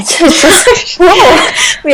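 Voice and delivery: laughing. Real, not acted. Co-host laughing helplessly while calling today sad. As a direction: laugh through the words.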